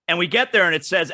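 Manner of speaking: ordinary talk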